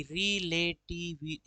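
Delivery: "रिलेटिवि-" softly counted out slowly, beat by beat; split into syllables. Shown as a demonstration. Teacher counting syllables to self, "रिलेटिवि-" slow and soft, each beat separate. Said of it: "पांच छह हो गए ना".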